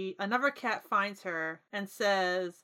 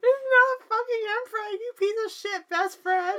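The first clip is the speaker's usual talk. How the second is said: high, loud outraged yell; playful yell; 12 semitones higher, 5 dB louder than usual. Acted. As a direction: scream it at your friend, half laughing. Mock-furious yelling at a friend.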